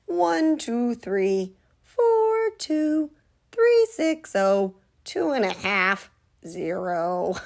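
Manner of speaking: silly squeaky marching count. Acted. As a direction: bounce through the count like a clown.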